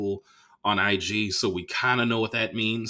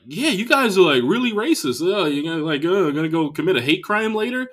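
mock Texan heckler; 6 semitones higher than usual. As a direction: mimic a smug comic lecturing the crowd.

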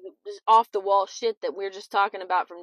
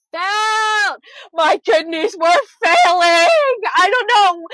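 1 shouting insistence; 8 semitones higher, 10 dB louder, slower than usual. Real, not acted. Insisting kidneys were failing.

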